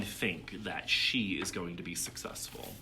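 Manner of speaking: usual speaking voice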